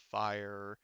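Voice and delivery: ordinary delivery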